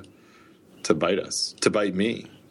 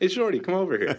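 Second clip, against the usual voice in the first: calling out; 9 semitones higher, faster than usual. Pretend. Calling to a tall guy.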